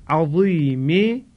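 عظیم drawn out slowly, its ظ thick and emphatic; a slow عظیم with a heavy, full-bodied ظ. Shown as a demonstration. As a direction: stretch عظیم and give the ظ full weight. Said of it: "باید درشت ادا بشه".

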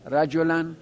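usual speaking voice

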